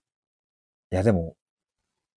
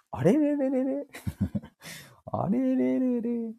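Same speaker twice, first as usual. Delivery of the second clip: high sing-song "あれれれれ", the second much slower; playful confusion; 14 semitones higher, 6 dB quieter, much slower than usual. Real, not acted. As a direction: bashfully pretend not to get why you're being praised. Flustered by repeated compliments. (褒められて照れた、高い声の「あれれれれ」)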